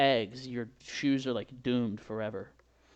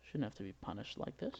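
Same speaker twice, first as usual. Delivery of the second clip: normal talking voice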